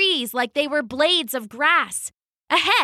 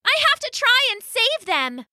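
high determined cry; 13 semitones higher than usual. Acted. Kaya resolves to rescue them.

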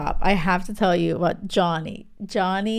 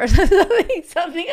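loud laugh; 9 semitones higher, 8 dB louder, faster than usual. Real, not acted. Laughs mid-retelling.

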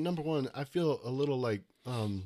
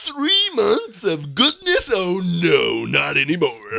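mock villain; 3 semitones higher, 11 dB louder, slower than usual. Playing the villain scene.

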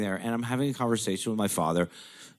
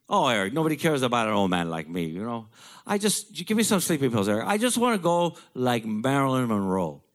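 put-on high, louder pleading old man; father pleading for sleeping pills, raised voice; imitating wistful father; 4 semitones higher, 4 dB louder than usual. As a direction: wheedle wistfully as dad.

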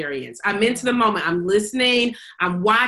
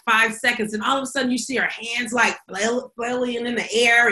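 ordinary delivery

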